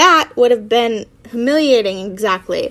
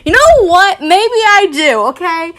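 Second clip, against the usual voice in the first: mock argument, higher and louder; arguing; 6 semitones higher, 5 dB louder than usual. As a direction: snap back as in a petty argument. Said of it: "This is me arguing with one of my friends".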